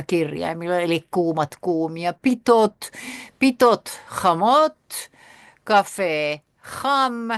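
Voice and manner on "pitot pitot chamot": "Pitot, pitot, chamot" loud and higher, with "pitot" each time falling and "chamot" rising; louder teaching voice. Shown as a demonstration. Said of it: "Vokabel laut vorgesprochen".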